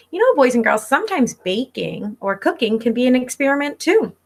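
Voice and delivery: ordinary delivery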